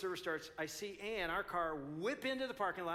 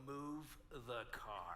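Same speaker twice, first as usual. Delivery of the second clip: much lower, softer, slow and stern; low stern hiss; 7 semitones lower, 10 dB quieter, much slower than usual. Retold or imitated. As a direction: lean in and say it under the breath. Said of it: "Just like that".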